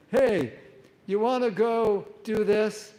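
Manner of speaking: loud, hammed-up tipsy call; mock drunk devotee. Retelling the play's drunk devotee, loud mock call. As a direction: play the intoxicated actor beckoning a friend. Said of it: "they're like".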